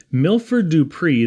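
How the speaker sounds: plain reading voice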